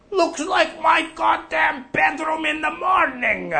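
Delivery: high, loud indignant shout; a mock fuming yell; angry outburst. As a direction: blurt it out like an angry farmer. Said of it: "Martin's really pissed off".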